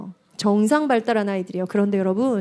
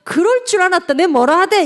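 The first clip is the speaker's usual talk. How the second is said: higher, louder mocking I-told-you-so; sarcastic gloating; 7 semitones higher, 5 dB louder than usual. Shown as a demonstration. Showing spouses what not to say, sarcastic jeering. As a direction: jeer it with raised pitch and volume. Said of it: "비아냥거리는 거 말고".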